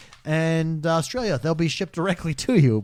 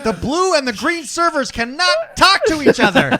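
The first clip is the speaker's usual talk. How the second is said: high, loud, overdramatic warning; mock alarm; 12 semitones higher, 5 dB louder than usual. Tech warning about the blue and green servers, mock-alarmed. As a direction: cry out with laughter under it.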